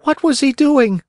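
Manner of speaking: high puppet voice; anxious Pinocchio. Acted. Pinocchio pressing for news of his father, high and anxious. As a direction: ask in a high, eager voice.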